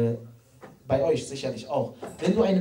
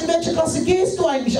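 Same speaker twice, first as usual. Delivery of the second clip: asking the little brother; 12 semitones higher, 5 dB louder than usual. Retold or imitated.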